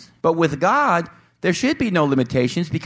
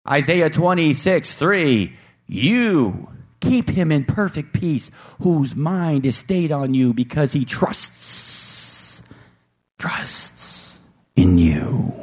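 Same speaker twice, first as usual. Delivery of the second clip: slow scripture reading; much slower than usual. Acted. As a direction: let the verse settle slowly into peace.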